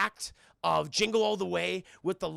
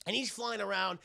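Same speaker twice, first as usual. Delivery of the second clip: ordinary talk, nothing marked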